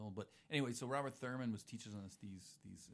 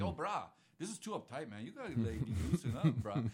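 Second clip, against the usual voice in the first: laid-back Hawaiian surfer; 8 dB louder than usual. Retold or imitated. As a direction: play a chill island local telling him to relax. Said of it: "So it was like".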